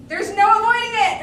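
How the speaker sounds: loud, high proclamation; fervent persuasion; grand insistence. Acted. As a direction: build to a ringing declaration. Schemer declares destiny.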